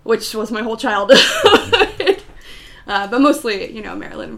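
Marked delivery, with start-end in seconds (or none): 1.2-2.8 high burst of laughter. Real, not acted. Laughs about childhood.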